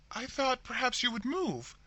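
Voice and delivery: hoarse, strained voice. A narrator's reading. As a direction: rasp the line out hoarsely. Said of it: "replied Rebecca hoarsely".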